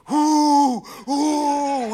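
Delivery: imitated high, drawn-out "ooh" hoots; mock psych-up whooping, long and held; weird sounds. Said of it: "weird, weird sounds".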